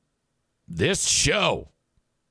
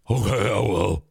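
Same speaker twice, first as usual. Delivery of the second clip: lightly put-on low voice, obedient; deep Ash voice; 4 semitones lower than usual. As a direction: lower mock-deep voice of Ash. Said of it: "Ash's voice is super deep now".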